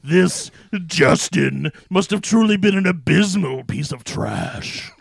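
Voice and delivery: theatrical demon pronouncement. Acted. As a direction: intone it like a scornful demon. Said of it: "From my demon voice".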